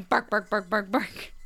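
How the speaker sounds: Bark, bark, bark said as a spoken mock bark; mock dog barks. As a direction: play a silly dog barking. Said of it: "He doesn't bark at anyone".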